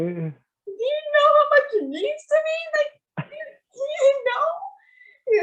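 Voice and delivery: very high, tearful mock wail; imitated crying. Daughter explaining her tears to dad, imitated high crying. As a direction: wail it way up high, dragging the last words. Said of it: "why are you crying?".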